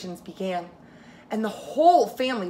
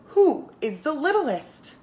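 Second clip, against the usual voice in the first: grandpa's question; 6 semitones higher, slower than usual. Acted. Grandpa asks at the Seder.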